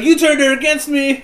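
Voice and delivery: hammed-up betrayed outburst, high and loud; Anakin. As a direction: wail the accusation melodramatically. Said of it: "like, an Anakin".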